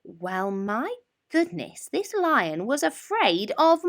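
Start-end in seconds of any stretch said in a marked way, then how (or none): none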